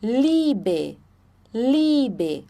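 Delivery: Liebe with a long i, LEE-be; German ie. Shown as a demonstration. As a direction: model Liebe, e silent after i. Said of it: "ali a gente não fala o E".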